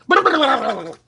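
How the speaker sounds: very loud exaggerated hacking; throat-clearing cough. Host clearing a frog from his throat, loud comic hacking. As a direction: cough up a frog, hugely overdone. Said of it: "Got to get the frog out of my throat".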